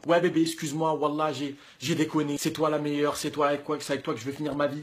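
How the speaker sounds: soft, low, syrupy pleading to the ex; mock sweet talk. Mocking the ex's sweet talk.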